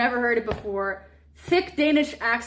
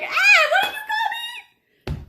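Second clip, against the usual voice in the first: much higher, loud laughing shriek of offence; mock outrage; 4 dB louder than usual. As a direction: shriek it, half laughing, as if insulted. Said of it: "And then I'd be like".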